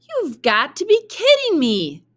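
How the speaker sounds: high, loud disbelieving yell at her son; yelling mother. Acted. Mother yells at Jack over the beans, high and loud. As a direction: screech it in outraged disbelief. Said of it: "yelled Jack's mother".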